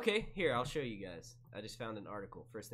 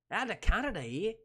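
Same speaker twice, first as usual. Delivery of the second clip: mock Canadian accent, pitched up; Canadian Bill Cosby; 7 semitones higher than usual. Streamer working out an impression, mock Canadian accent. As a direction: try out a swooping Canadian accent. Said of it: "trying to think of how that would sound".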